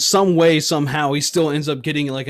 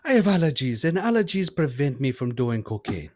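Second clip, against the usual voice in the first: mock West African accent; 3 semitones higher, 3 dB louder than usual. Comic character introducing himself, West African accent.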